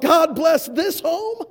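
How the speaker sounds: sarcastic echo. Retold or imitated. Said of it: "I thought to myself".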